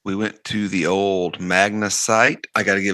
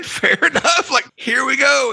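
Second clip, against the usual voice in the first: laughing; 3 dB louder than usual. Real, not acted. Laughing at the coincidence.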